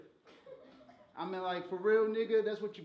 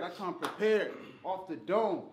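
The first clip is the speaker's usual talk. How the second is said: ordinary talk, nothing marked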